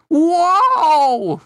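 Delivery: high, loud, long-held whoa; big cartoonish gasp of wonder; awestruck whoa. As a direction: draw it out in huge amazement. Larry awestruck by the girl.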